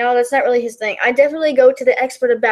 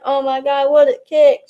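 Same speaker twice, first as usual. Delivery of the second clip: unenthusiastic commentary. Retold or imitated. Imitating a coworker commentating on a kick, unenthusiastic. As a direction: call the kick without much enthusiasm, near everyday pitch. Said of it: "And she'd be like".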